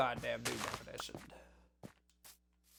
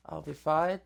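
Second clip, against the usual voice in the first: ordinary delivery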